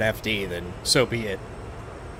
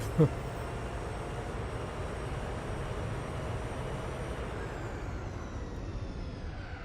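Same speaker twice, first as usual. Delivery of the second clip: breathy laugh; 14 dB quieter than usual. Real, not acted. Laugh after viral video joke.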